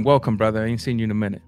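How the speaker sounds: normal talking voice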